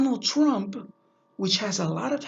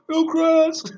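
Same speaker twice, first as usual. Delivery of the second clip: higher, louder cheer of the name; proud shout-out; 7 semitones higher, 6 dB louder than usual. Real, not acted. Vlogger cheering his old high school's name, excited. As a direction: call out the school like a fan. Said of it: "the high school was Hillcrest High School".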